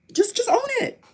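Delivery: high-pitched, exasperated insistence; exasperated demand. Real, not acted. Ranting at those they accuse of hiding their evil, exasperated.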